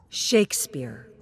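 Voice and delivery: usual speaking voice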